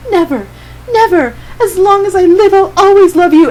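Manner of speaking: high, loud, rising to a cry; desperate vow. Acted. Heaven begging Andy as he leaves, high and loud. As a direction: cry it out, swearing forever.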